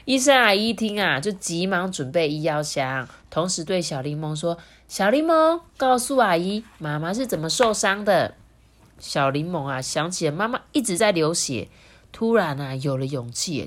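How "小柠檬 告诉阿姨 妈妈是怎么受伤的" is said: higher auntie voice, settling as it goes; questioning auntie. A narrator's reading. Doctor auntie asks how mum got hurt, coaxing. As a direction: prompt the child to explain.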